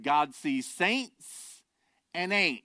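a hint of a slow Southern drawl, very slow; J. Vernon McGee's Southern drawl. Pastor quoting a famous preacher, mild Southern drawl. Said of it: "The Southern drawl".

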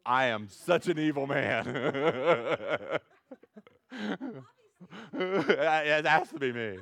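slow, loud laughing mock evil man; laughing self-mockery. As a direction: laugh at himself, slow and big. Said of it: "blowing his top kind of anger".

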